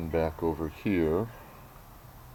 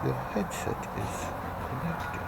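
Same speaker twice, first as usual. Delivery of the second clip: airy, higher, posh-English imitation of a headset voice; mock proper English accent; 7 semitones higher, 9 dB quieter than usual. Vlogger mimicking the earbud's proper English accent voice prompt. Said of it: "in her proper English accent".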